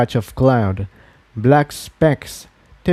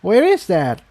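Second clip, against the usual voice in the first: higher, curious question; lightly voiced young mare; 6 semitones higher than usual. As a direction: ask simply, a little lost. Tiptoe asks the old mare.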